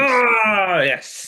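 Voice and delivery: long, high, loud "ah" falling into a yes; gleeful winner's cheer; triumphant cheer. Real, not acted. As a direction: celebrate winning with a drawn-out cry. Wins the coin toss.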